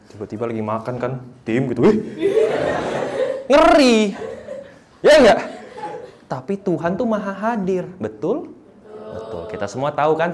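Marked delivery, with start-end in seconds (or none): 1.4-5.4 high, loud, laughing "Ngeri"; comic spooked laugh at the thought; laughing mock fright. Imagining pastor watching.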